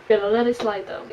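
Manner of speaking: normal talking voice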